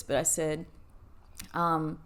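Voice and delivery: ordinary talk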